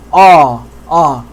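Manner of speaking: "ah" deep and back, almost "aw"; thick, rounded-back vowel on "ah"; heavy fatha vowel. Shown as a demonstration. Teacher showing the other letters' fatha, thick vowel. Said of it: "the fatha is gonna sound like this".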